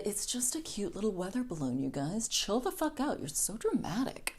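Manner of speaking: soft, eye-rolling dismissal; dismissive brush-off. Retold or imitated. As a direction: wave it off like they're overreacting. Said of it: "the Chinese government said".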